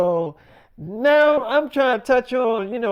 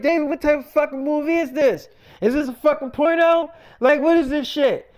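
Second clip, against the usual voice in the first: imitating an outraged woman, higher pitched; angry disbelief; 7 semitones higher than usual. Woman questioning David about the movie request, angry disbelief. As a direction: voice her indignant questions, pitched well up. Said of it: "she was so damn angry".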